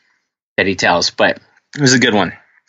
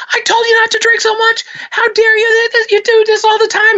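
scolding wife. Acted. Wife scolding drunk husband, in high falsetto fury. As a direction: shriek up high like a furious wife.

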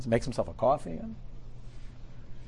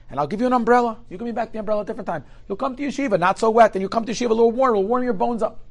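high and fast, fussing over him; urgent kindness; 10 semitones higher, 7 dB louder, faster than usual. Retold or imitated. Kind man fussing over a soaked student, high and fast. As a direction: hurry the soaked boy in like a doting uncle.

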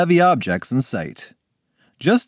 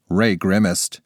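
ordinary delivery

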